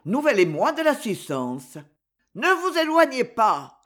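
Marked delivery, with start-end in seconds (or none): 2.4-3.8 higher, louder call of warning; warning shout. Acted. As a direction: call out to the swimmer anxiously. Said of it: "cria le monsieur en agitant". (cri d'avertissement, plus aigu)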